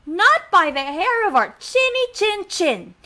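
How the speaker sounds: high sing-song refusal; little pigs. Acted. Storyteller prompts kids to reply as pigs, high chant. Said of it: "And they said".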